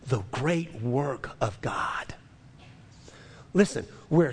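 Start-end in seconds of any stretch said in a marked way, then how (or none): 0.1-2.1 hushed whisper to close the thought; soft unvoiced whisper of awe. Real, not acted. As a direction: breathe the words, almost no voice. Preacher drops to hushed close.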